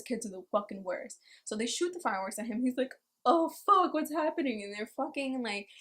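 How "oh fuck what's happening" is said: high, loud startled cry; startled yelp. Acted. Homeless man woken by fireworks shot at him, panicked high yelp. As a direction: jolt awake in a panic.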